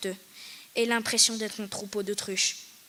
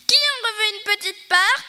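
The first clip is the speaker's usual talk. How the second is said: high, loud, sing-song hostess offering more; eager old hostess; 8 semitones higher, 7 dB louder than usual. A narrator's reading. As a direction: offer cake brightly, higher and louder. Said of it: "a demandé Madame Schwarzenbaum".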